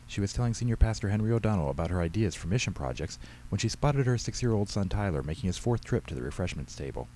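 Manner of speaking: ordinary delivery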